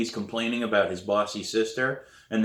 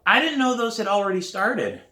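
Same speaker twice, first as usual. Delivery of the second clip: much higher, louder excuse; protesting student; 12 semitones higher, 5 dB louder than usual. A narrator's reading. Sam to principal about detention, high-pitched protest. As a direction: plead innocence in a high voice.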